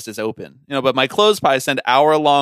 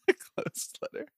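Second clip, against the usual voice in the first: laughing whisper; 15 dB quieter, slower than usual. Real, not acted. Laughing repeat of joke.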